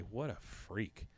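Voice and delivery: low-key, drawn-out onlooker remark; slow, soft mock aside; audience aside. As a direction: say it softly and slowly from the crowd. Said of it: "hear somebody out in the audience say".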